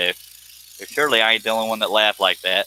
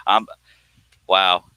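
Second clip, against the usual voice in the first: ordinary talk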